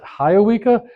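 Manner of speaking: "Hiawika" in four syllables, opening like "high"; pronounced with extra syllable. Shown as a demonstration. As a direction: say "Hiawika" as high-uh-WEE-kuh. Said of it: "some people pronounce it".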